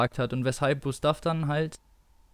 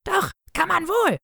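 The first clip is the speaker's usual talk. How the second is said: hoch und schrill gekreischt, eine irre Schwester. Acted. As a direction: kreisch ihm den Widerspruch hoch entgegen.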